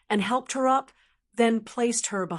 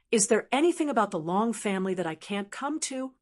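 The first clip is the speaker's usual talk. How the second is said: plain reading voice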